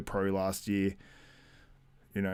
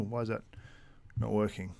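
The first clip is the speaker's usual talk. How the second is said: usual speaking voice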